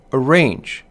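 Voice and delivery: "arrange" with a light first syllable, stressed second; iambic stress. Shown as a demonstration. Lecturer giving an example of iambic rhythm, "arrange" stressed on "range". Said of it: "hear the rhythm of the iamb".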